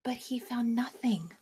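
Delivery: soft whispered letdown. A narrator's reading. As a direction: whisper it quietly. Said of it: "whispered narration".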